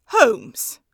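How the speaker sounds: loud, urgent cry of a name. A narrator's reading. Watson cries out to Holmes in alarm, loud urgent cry. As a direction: call out to him in alarm. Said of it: "I cried".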